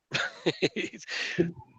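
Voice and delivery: high, giggly chuckle; laughing. Real, not acted. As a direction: chuckle at a shared joke. Shared joke about the comma.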